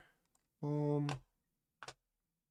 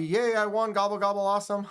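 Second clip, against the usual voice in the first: higher, louder mock celebration; excited cheering; 6 semitones higher, 6 dB louder, much faster than usual. Streamer reading a viewer's winning comment, excited mock cheer. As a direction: cheer like a winner, playfully.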